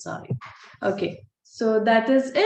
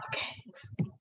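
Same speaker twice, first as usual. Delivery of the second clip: whisper; 14 dB quieter than usual. Real, not acted. Family arriving, aside.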